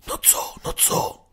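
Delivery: eager whispered questions; whisper, dying to know; curious whisper. Acted. Curious children whisper to Waluś, eager. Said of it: "zaciekawiły się dzieci".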